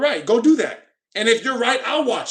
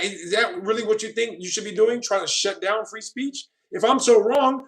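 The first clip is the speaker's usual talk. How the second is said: ordinary talk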